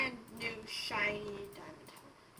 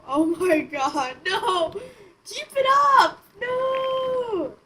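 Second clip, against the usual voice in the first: panicked yelling; 8 semitones higher, 10 dB louder, much slower than usual. Real, not acted. Alarm as horse falls.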